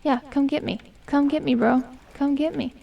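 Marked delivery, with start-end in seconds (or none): none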